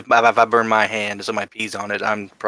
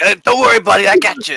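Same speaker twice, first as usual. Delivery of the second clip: high, loud, hurried buddy-to-the-rescue call; high-pitched helpful holler; eager reassurance; 8 semitones higher, 6 dB louder than usual. Acted. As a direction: pipe up loudly to reassure a hurt friend. Character rushing to help burnt companion.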